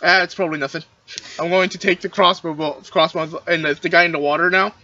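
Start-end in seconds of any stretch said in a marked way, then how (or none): none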